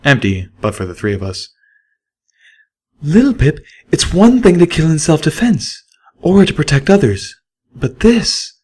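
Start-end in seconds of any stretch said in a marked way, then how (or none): 3.0-8.5 high pleading mare voice; a mare begging her friend to stop; higher, earnest mare; Velvet Remedy. A narrator's reading.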